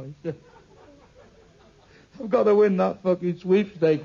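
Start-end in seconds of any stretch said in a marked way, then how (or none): 2.2-4.0 desperate pleading. Acted. Man begging God in prayer.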